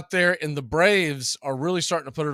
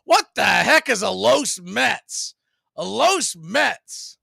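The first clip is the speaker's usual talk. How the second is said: slow, higher mock-baffled taunt; loud taunting; 7 semitones higher, 5 dB louder, much slower than usual. Heckling Mets fans in the stands, loud and mocking. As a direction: needle the rival fans, drawn out. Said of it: "start getting really loud and really vocal".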